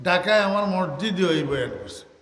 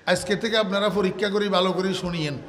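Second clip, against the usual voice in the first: normal talking voice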